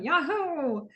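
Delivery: high but restrained cheer. Retold or imitated. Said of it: "with a little more enthusiasm".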